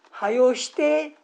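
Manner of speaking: 「はよして」をゆっくり強めに急かす; 急かすように声を張って; urging. Shown as a demonstration. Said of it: "とも言いますし". (せかす口調で「はよして」)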